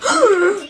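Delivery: exaggerated high gasp of horror; hammed-up shocked intake of breath; mock shocked gasp. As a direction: gasp loudly as if appalled. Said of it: "they'll be like".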